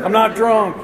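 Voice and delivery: high, insistent mock protest; defensive drunk denial. Drunk son to mother after smoke alarm, defensive denial. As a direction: protest your innocence a bit too loudly.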